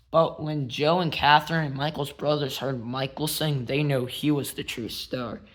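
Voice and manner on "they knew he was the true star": ordinary delivery, nothing marked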